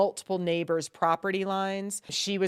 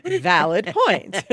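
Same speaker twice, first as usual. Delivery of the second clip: laughing; 9 dB louder, much slower than usual. Real, not acted. Laughing at joke.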